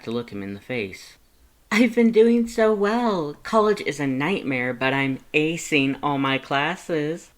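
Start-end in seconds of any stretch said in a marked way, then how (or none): 1.7-7.3 put-on high, louder, bubbly young voice; cheerful Frisk voice. Frisk telling Lust about college, high and bubbly. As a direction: brag happily to a friend.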